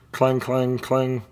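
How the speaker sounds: a bell's clang, sung out high; imitated cable car bell. A narrator's reading.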